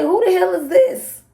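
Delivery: high, loud alarmed yell; startled shout. Retold or imitated. Shouting through the door at the stranger, alarmed.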